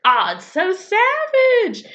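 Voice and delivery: high, drawn-out squeal of delight; real delighted shriek; gleeful outcry. Real, not acted. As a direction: draw it out loud and high, scandalised and thrilled. Gleeful reaction to a brutal insult.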